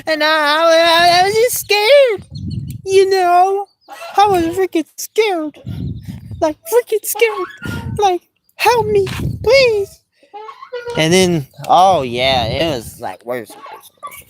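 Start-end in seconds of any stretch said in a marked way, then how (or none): 0.0-10.0 put-on scared kid. Joseph's scared reply, retold.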